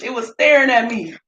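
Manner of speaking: ordinary talk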